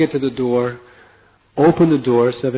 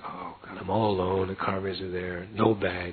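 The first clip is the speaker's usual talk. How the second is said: softer, lower sorry-for-myself mutter; mock self-pity; 4 semitones lower, 10 dB quieter than usual. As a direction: moan quietly to yourself, all alone. Self-pity alone at airport.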